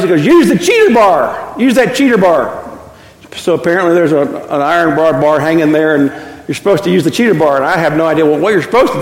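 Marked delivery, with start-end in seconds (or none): 0.3-2.7 retold yell. Grandfather yelling at the boy at the gate, a slow mock shout. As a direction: bark the order across the field like the grandfather. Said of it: "he, he yells".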